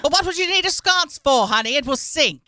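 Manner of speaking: high, loud, theatrical Mid-Atlantic objection; Mid-Atlantic movie reply. Acted. Roger answering his honey at sea, hammed-up Mid-Atlantic accent. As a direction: answer like an old-film actor.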